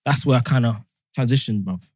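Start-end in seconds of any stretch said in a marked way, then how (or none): none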